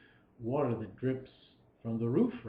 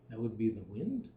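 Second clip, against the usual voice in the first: ordinary talk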